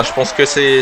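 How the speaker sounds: ordinary delivery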